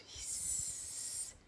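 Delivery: slow whisper, then a soft hiss. Acted. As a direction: hiss it like a hidden snake. Snake noise from wheel.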